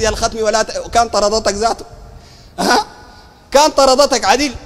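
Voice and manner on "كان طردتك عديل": usual speaking voice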